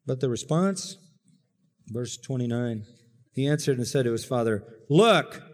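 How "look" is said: curt, scornful look; disdainful look. Acted. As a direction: toss it off with contempt. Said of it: "That's an eye roller".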